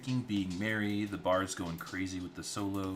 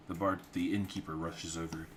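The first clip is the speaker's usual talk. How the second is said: normal talking voice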